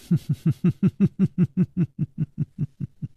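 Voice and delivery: long, staccato high laugh; string out a run of light ha-has; mocking laugh. A narrator's reading. Character laughs before accusing.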